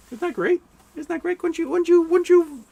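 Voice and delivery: very high-pitched playful cooing; silly high voice. Pretend. Interrupting his own story to coo, silly high voice.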